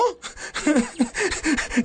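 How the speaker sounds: risa aguda y burlona. Acted. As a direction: carcajada de burla infantil. Niños se burlan del nombre. (risa de niño burlón)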